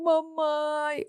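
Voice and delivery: put-on small child's slow high wail of mamãe; the toddler calling for mom, stretched and high; child crying for mom. Mother imitating her son crying mamãe on video.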